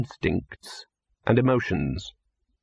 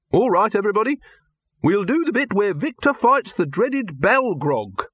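high-pitched call to the crew; film director announcing; 9 semitones higher than usual. Acted. Director announces the next scene to everybody, high and commanding.

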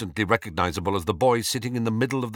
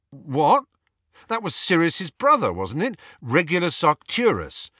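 surprised; 7 semitones higher, 4 dB louder than usual. A narrator's reading. Ron reacts to the sign.

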